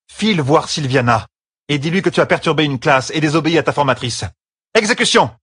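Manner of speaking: high-pitched scolding command, rising to a shout; stern order. Acted. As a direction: send the pupil off angrily. Angry sister dismisses pupil to punishment.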